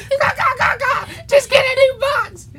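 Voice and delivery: high, cackling laugh over the words; mocking laugh. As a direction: laugh at him while telling him off. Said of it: "this girl's over here laughing like".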